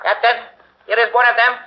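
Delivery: pitched well up, urgent and startled; lieutenant alarmed at finding a dead guard; alarmed call. Acted. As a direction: call the captain over in alarm.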